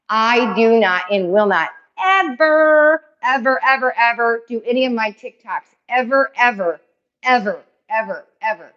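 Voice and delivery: emphatic chant of ever. Real, not acted. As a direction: swear it will never happen, ever. Emphatic never about TikToks.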